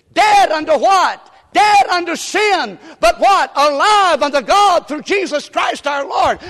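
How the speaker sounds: loud, high call and response; preaching at full force. Acted. Preacher calling the congregation to answer, full force. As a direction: drive it home to the congregation.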